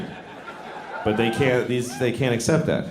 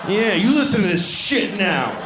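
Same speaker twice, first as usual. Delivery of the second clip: ordinary delivery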